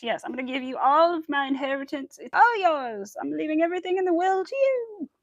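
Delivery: high, loud sarcastic generosity; hammed-up eager giving; mock gullible gushing. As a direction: pretend to gush to a total stranger. Sarcastic acting of a gullible victim.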